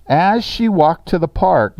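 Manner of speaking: slow, deliberate "As she walked to the park."; slow reading. Shown as a demonstration. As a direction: read the fragment slowly for the class. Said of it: "let's move on to number three".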